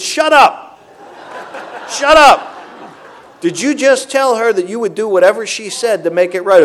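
high, loud "shut up" with a laugh in it; sponsor cutting him off. Retold or imitated. Sponsor on the phone silencing his excuses, loud and amused. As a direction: cut off the excuses, half laughing.

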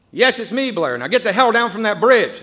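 loud, high, firm order; firm command. Retold or imitated. Sponsor ordering the man off the bridge, loud and firm. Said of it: "Bob says".

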